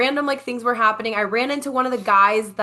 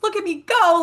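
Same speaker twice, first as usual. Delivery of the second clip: higher-pitched, playful little boast; proud self-cheer; 11 semitones higher, 4 dB louder than usual. Retold or imitated. Retelling how proud she was of her earrings, proud self-cheer.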